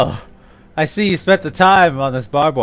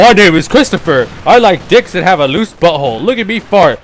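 mocking imitation of a friend, louder and higher; exaggerated lilting put-on voice; Christopher; 4 semitones higher, 6 dB louder than usual. Mocking a friend by faking his voice, exaggerated. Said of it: "say that you faked my voice".

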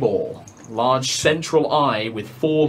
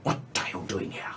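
quick, much softer whisper; fast hissed whisper; 9 semitones lower, 14 dB quieter, much faster than usual. Acted. Eye monster questions the intruders, fast soft whisper. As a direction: whisper it fast and low, menacing.

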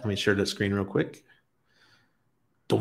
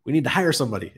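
higher, half-joking alarm; mock panic; 6 semitones higher, faster than usual. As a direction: play the couple's January scramble as comic alarm. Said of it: "we're like".

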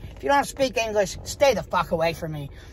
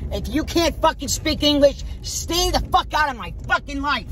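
real angry rant, pitched up; fed up; 6 semitones higher than usual. Real, not acted. Fed-up customer ranting about Dunkin' Donuts staff, angry. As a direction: vent sharply higher, at the end of your patience. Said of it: "I seriously can't take this shit anymore".

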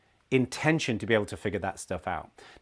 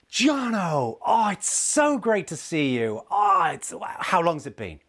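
gushing, much higher and slow, laying it on thick; overdoing it; 7 semitones higher, slower than usual. Retold or imitated. Imitating an overly enthusiastic friend greeting Jono, gushing and much higher. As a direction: ham up an over-the-top reunion. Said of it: "just overdoing it".

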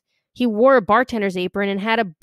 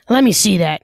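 loud demand; 7 dB louder than usual. A narrator's reading. Bartender snatching key.

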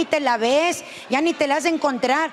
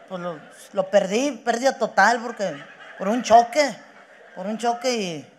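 mock tearful; 4 semitones lower than usual. [name] telling how he lost his car, mock tearful. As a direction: trail off low as if fighting tears. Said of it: "con ojos llorosos".

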